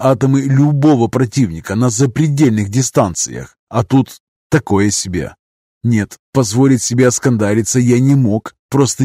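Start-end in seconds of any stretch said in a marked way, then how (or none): none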